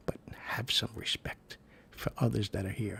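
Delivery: hushed, stern church whisper; scolding whisper. Retold or imitated. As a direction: tell them off in a whisper. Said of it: "but we were whispering".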